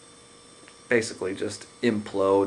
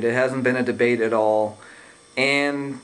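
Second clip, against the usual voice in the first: ordinary talk, nothing marked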